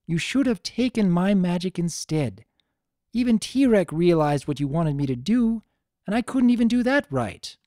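put-on a distressed young heroine blaming herself; upset young mare. Twilight continues, upset.

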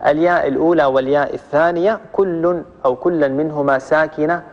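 ordinary talk

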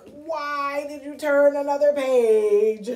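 loud, slow, indignant demand; mock angry. Shown as a demonstration. Showing children Grover might be angry, loudly indignant. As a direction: draw it out as an angry scolding. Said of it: "angry, like".

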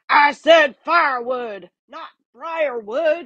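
high, slow yelping complaint. Acted. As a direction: yelp in pain from the thorns. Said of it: "he yelped".

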